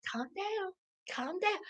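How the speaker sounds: hushed mock calming; barely voiced, patronizing little murmur; imitating a husband shushing. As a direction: say it very softly, mimicking him shushing her. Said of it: "He's telling her to calm down".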